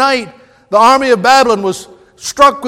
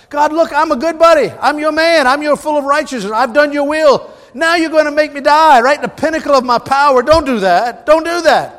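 higher, whiny pleading with God; pleading king; 5 semitones higher than usual. Retold or imitated. Preacher voicing Hezekiah begging God not to let him die, higher whiny plea. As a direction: beg like a spoiled good buddy.

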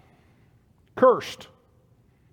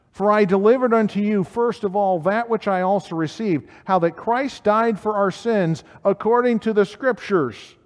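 raised, emphatic preaching voice; scripture proclamation; 5 semitones higher, 4 dB louder than usual. Acted. Preacher quoting the Apostle Paul's statement, proclaiming. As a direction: declare the verse high and forcefully.

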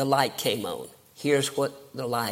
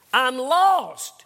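louder, higher insistent repeat; 8 semitones higher, 7 dB louder than usual. Retold or imitated. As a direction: push it out, pleading to be understood.

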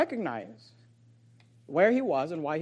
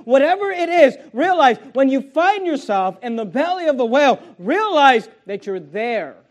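full-force preaching; 5 semitones higher, 8 dB louder than usual. Real, not acted. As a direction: drive each example home to the congregation, loudly.

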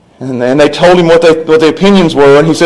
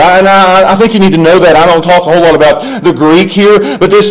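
ordinary talk

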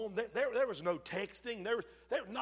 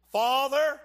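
Father called out loud and high; loud prayerful call; 6 semitones higher, 9 dB louder than usual. Acted. Jesus praying at the tomb, loud call. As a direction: lift the voice to heaven.

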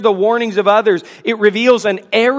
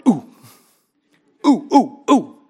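hand-raising ooh ooh ooh, pitched up; a kid straining to be called on; eager kid's ooh-ooh. Retold or imitated. Preacher imitating an eager kid begging the teacher to call on him. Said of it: "you made this sound".

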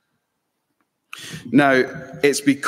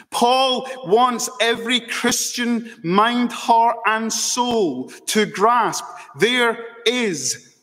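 raised, ringing preacher's voice, pitched higher; impassioned preaching; 9 semitones higher than usual. Acted. Preacher urging his congregation to grasp no condemnation, impassioned. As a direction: preach it out, lifting each no and never.

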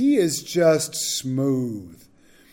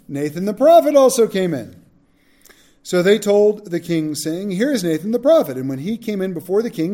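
measured, formal Bible reading with a raised voice; scripture reading; 3 semitones higher, 4 dB louder than usual. Acted. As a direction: read the passage to the class, steady and solemn. Reads scripture aloud.

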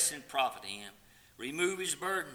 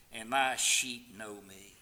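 usual speaking voice

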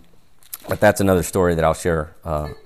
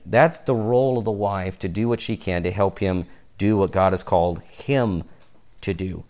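normal talking voice

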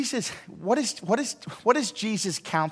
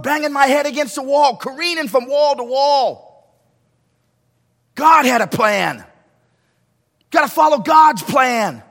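full-force preaching; 12 semitones higher, 11 dB louder than usual. Acted.

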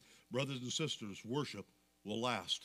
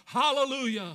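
loud, high shout of praise; crying out; 11 semitones higher, 10 dB louder than usual. Acted. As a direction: cry out like a great multitude. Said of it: "crying out".

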